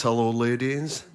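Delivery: slow, lightly posh Edinburgh pilot; posh Edinburgh accent. Retold or imitated. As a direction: imitate a posh Edinburgh captain. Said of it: "that posh Edinburgh voice".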